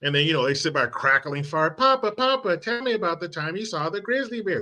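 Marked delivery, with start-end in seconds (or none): 1.7-4.6 put-on a high, bouncy kid's voice asking Papa; child voice. Grandchild greeting grandpa at his cabin, high kid voice. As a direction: pipe up like an eager grandchild.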